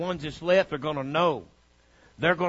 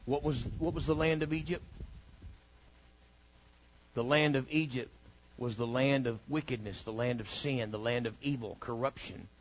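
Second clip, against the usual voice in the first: quiet preaching; 4 semitones lower, 9 dB quieter than usual. Acted. Quiet falling preacher line.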